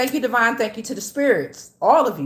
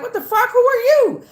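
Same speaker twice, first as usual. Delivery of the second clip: much higher, louder mock outrage; hammed-up indignant challenge; 14 semitones higher, 5 dB louder than usual. Spirit barging into her energy field, mock outrage. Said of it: "It was like".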